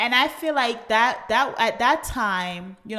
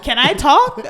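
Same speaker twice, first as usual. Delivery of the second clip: ordinary delivery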